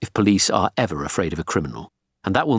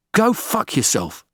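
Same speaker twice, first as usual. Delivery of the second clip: slow, high-pitched furious retort; defiant captive's angry outburst; angry defiance; 9 semitones higher, slower than usual. A narrator's reading. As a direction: spit the refusal out, drawing each word out. Captive defies gunman's order.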